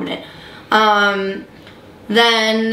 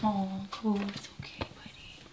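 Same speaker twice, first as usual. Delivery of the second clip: soft whispered reassurance; soothing a scared dog; 2 semitones lower, 15 dB quieter than usual. Real, not acted. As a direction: hush and calm a frightened pet. Calming frightened pet after crash.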